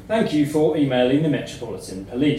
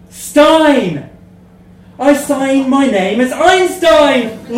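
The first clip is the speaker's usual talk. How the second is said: triumphant reveal; 9 semitones higher, 7 dB louder, much slower than usual. Acted. Big reveal of the pun.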